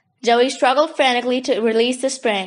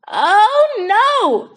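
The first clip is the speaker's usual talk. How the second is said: higher, louder mock-dismayed cry; groaning protest; 9 semitones higher, 5 dB louder than usual.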